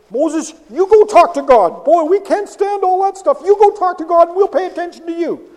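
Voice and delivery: loud, high, desperate plea to Moses; frightened plea. Acted. The terrified people begging Moses to face God, loud and high.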